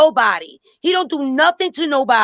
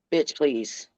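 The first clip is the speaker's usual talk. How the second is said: dismissive; 8 semitones lower, 9 dB quieter than usual. Real, not acted. Dismissive jab at Chelsea.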